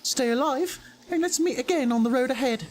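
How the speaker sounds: high, chipper, lightly bratty adventurer; proud juvenile voice with a cockeyed British accent; Cloth. Acted. Game character greeting a fellow adventurer, high chipper cockney-ish voice. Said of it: "The voice that I had for Cloth before".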